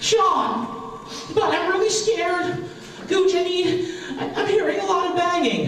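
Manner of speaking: high, slow, frightened mother voice; squeaky mom who heard banging; scared mom voice. Retold or imitated. Mimicking his mom, frightened, at the den door.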